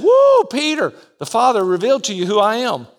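recalling Jesus' upbeat praise of Peter; excited praise. Acted. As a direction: cheer him on fast.

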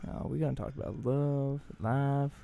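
soft mock late-night DJ; hammed-up smooth radio voice; smooth radio co-host.

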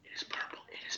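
hushed, breathy insistence; a quick unvoiced whisper. Real, not acted. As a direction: whisper it back under your breath. Whispered insistence on shirt color.

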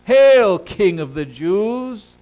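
loud, high ceremonial hail; booming "Hail", then drawn-out title; mocking soldiers' salute. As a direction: shout a grand mock salute. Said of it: "began to salute him".

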